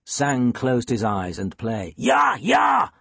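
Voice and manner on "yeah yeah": high, exultant shout of encouragement. A narrator's reading. Cello teacher cheering on his student, shouted. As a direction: cheer the player on at full pitch. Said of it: "Jensen shouted".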